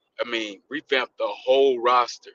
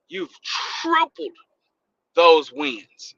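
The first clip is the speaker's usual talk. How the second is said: excited; 6 semitones higher, 7 dB louder, slower than usual. Real, not acted. Excited about team's wins.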